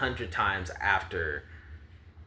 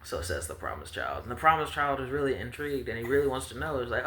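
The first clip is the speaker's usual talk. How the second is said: ordinary talk, nothing marked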